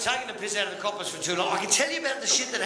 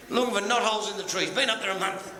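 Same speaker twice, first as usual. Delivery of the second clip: normal talking voice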